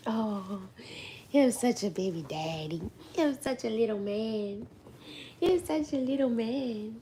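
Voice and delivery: put-on soft baby talk. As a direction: murmur lovingly to the baby. Cooing at baby softly.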